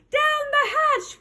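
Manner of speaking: high, slow group cheer; crew shout. A narrator's reading. Crew echoes the captain at dinner, shouting. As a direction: echo the captain, stretched out high. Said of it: "we all shouted".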